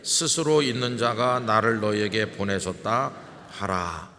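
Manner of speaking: loud, measured unison reading; scripture reading. Acted. Pastor leading the church in reading scripture, firm and loud. Congregation reads scripture together.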